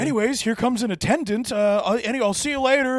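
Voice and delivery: high, hurried hotel host; nervous mock host. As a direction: brush him off sweetly.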